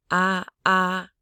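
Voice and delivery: "ah" on a steady middle note, like re; neutral tone. Shown as a demonstration. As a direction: hold "ah" level in the middle of the range. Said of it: "This is the neutral tone".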